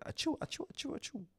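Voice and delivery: normal talking voice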